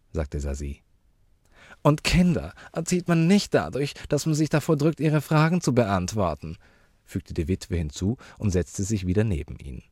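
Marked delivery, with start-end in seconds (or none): 1.8-6.6 high, affected lecturing; reproving widow. Acted. Widow lecturing the uncle on raising children, high and reproving.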